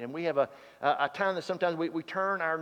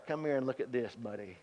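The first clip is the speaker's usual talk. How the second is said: quiet knowing; 5 semitones lower, 7 dB quieter, faster than usual. Retold or imitated. Al catching Buddy out.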